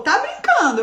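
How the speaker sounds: high shocked question; surprised. Retold or imitated. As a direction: gasp it in disbelief. Shocked boyfriend reaction.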